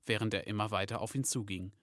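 ordinary delivery

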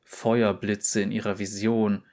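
usual speaking voice

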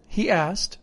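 usual speaking voice

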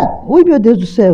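sudden frightened yelp; startled cry. Real, not acted. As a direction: jump with a real fright. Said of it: "Que susto!".

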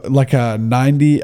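normal talking voice

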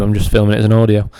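ordinary delivery

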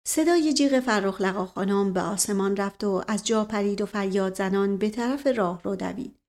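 plain reading voice

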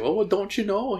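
put-on high, sing-song Canadian accent. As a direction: chirp it like a friendly Canadian. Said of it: "She had a really cute Canadian accent".

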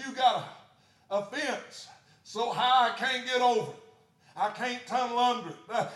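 loud, high preaching; whooping sermon cry; full-force preaching. Acted. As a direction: preach it with fire.

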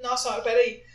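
choked up, coughing through words; talking while choking on a drink. Real, not acted.

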